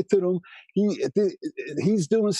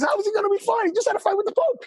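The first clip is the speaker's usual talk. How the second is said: much higher, frantic mock panic; panicked cousin. Cousin calling about the Pope fight, high frantic panic. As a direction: squeal it like the sky is falling. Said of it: "He goes".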